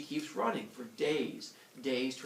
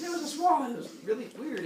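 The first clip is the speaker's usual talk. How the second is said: high, flustered mock-girl voice; Leto; 11 semitones higher, 5 dB louder than usual. Girl explains to jealous wife, high flustered protest. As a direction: blurt the excuse up high. Leto repeats her excuse.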